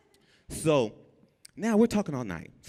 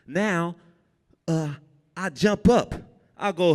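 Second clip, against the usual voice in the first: ordinary talk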